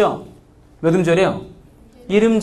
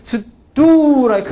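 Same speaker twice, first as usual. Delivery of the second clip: louder, slower, Korean-style call of 스트라이크; mock strike call; 7 semitones higher, 6 dB louder, much slower than usual. Shown as a demonstration. Teacher acts out asking a foreigner, mock call. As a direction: drag 스트라이크 out loud as a test. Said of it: "내가 하는 게 무슨 말인지".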